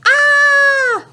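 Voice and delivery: high, loud, sustained "ah"; long, high-pitched yell; yelled scream. Retold or imitated. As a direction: act out a spider-scare scream. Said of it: "And then I said".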